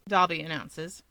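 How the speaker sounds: ordinary delivery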